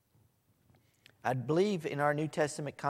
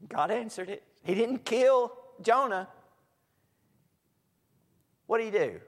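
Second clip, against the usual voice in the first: ordinary talk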